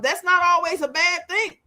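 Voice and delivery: usual speaking voice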